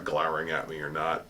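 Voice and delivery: ordinary talk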